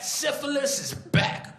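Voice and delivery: milk it like a movie trailer; hammed-up, high ad read; dramatic ad voice. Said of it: "That's a real ad".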